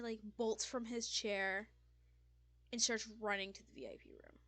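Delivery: ordinary talk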